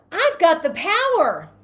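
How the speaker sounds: high, loud, slow proclamation; joyful affirmation. Shown as a demonstration. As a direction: declare it with triumphant joy.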